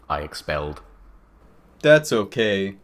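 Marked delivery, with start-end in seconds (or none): 1.8-2.8 understated, downcast acted voice; depressed. A narrator's reading. Boy accepting an apology, mildly depressed. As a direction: answer with a touch of gloom. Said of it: "he said, all depressed".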